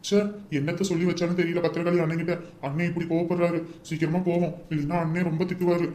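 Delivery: dejected talk to oneself after a scolding; worried grumbling. Acted. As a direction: grumble under your breath. Scolded brother grumbles to himself.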